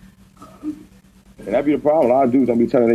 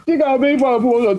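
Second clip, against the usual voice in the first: ordinary talk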